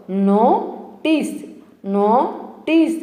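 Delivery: "నోటీస్" slowly broken into NO, then TEES; split pronunciation. Shown as a demonstration. Teacher teaching chunk by chunk, slow split "నోటీస్". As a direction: separate the two chunks of "నోటీస్" with a gap. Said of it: "విడదీసి పలకడం ద్వారా".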